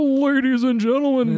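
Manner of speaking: high showman announcement; [name] impression. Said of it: "[name] impression".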